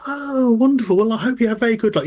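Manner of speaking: high, quick, not really interested; polite small talk. Acted. Conductor half-interested in the hunter's path, polite small talk. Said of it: "doing that kind of polite small talk".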